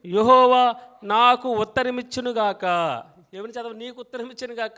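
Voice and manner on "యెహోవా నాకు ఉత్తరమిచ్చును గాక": loud, ringing congregational recitation; louder call-and-response verse reading; preaching at full force. Acted. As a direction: lead the church in reading the verse, loudly. Leads congregation reading together.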